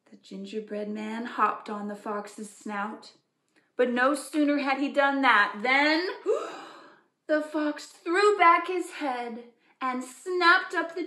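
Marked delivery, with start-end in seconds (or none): 3.8-11.1 louder, higher rush to the bite; gasping storyteller climax; dramatic build. A narrator's reading. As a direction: build suspense to the snap.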